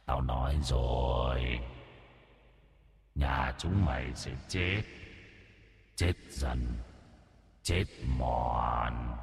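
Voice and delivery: a menacing ghost whispering doom, slow; taunting demon. Acted. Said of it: "Nó cất cái giọng khiêu khích".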